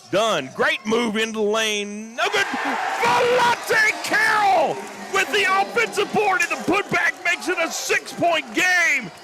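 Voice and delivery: screaming announcer, stretching the big words; ecstatic play-by-play. Real, not acted. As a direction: build to high, loud, sustained shouting. Live call of putback dunk.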